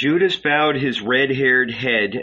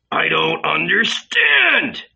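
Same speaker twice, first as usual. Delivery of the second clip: high, slow anguished cry; shouting; 7 semitones higher, 5 dB louder, slower than usual. Acted. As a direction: wail it out in bewildered distress. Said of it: "Don't shout, Judas".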